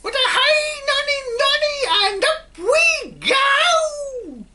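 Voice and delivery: put-on shrieking old hag's whoop, very high and loud; cackling witch-like cry of glee; old hag shrieking with delight; screeching shout. A narrator's reading. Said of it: "she shouted".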